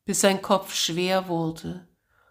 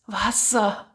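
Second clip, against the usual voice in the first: hoarse cry; 4 semitones higher than usual. Acted. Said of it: "bis er einen heiseren Schrei ausstieß".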